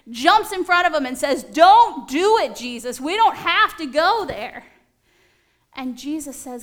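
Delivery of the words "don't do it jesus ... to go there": loud, slow pleading cry; urgent plea. Acted. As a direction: beg him to stop, loud and drawn out. Peter pleads with Jesus.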